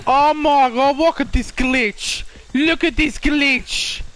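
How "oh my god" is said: usual speaking voice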